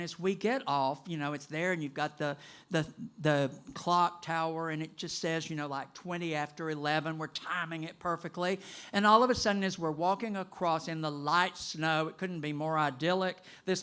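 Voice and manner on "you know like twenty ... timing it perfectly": usual speaking voice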